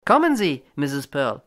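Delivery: Landy calls her firmly from the door; calling out. A narrator's reading. Landy calling Mrs Pearl away from the bowl, loud. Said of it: "rief er".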